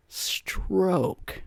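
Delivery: high, soft, drawn-out put-on voice; performed punchline word. Shown as a demonstration. Comic says stroke to make girlfriend laugh, teasing put-on voice. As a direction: stretch STROKE out high and quiet. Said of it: "or I'll say".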